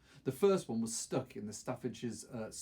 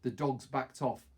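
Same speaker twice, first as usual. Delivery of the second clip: ordinary delivery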